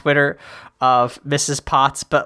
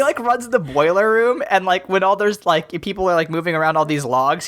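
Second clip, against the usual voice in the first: normal talking voice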